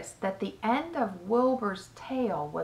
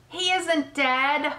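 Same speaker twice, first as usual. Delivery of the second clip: high, drawn-out protest; hollering; 7 semitones higher, much slower than usual. A narrator's reading. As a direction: holler it slowly, stressing each word. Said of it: "hollered Mister Zuckerman".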